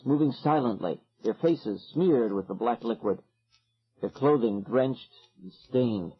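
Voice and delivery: usual speaking voice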